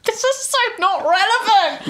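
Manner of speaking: laughing outburst, up high; cracking up as you say it. Real, not acted.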